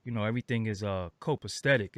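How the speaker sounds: low, raspy voice just woken up; raspy morning voice. Real, not acted. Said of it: "my voice sound a little deeper".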